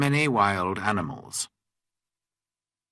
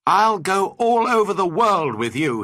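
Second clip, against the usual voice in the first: put-on high, loud boy's exclamation; excited pledge, pitched up; eager cry; 9 semitones higher, 5 dB louder than usual. A narrator's reading. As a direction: cry it out keenly in a lifted voice. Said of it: "he cried".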